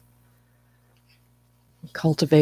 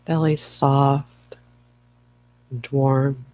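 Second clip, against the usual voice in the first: guided meditation. Acted. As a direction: ease listeners into stillness, phrase by unhurried phrase. Leads a guided breathing meditation.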